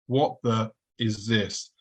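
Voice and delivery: drawn-out, slowed-down puzzled question; slowly repeating the question, baffled; slow bewildered repeat. Retold or imitated. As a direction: stretch each word out in disbelief. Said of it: "And I'm thinking".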